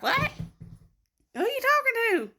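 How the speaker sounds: high, drawn-out imitation of a deaf dad; hard-of-hearing dad. Daughter imitating her hard-of-hearing dad, high mock calling. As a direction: call it out slowly, mimicking a confused dad. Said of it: "my dad don't hear good".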